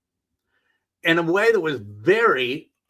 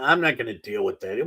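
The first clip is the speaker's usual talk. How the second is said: ordinary talk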